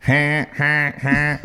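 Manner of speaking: high bleating ha ha; sheep bleat imitation. Shown as a demonstration. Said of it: "maybe more like that".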